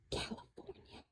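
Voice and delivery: barely voiced, whispered word; pained whisper. Telling of the Dodgers' move that broke his heart, pained whisper. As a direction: whisper it, as if unspeakable. Breaking news too painful to say.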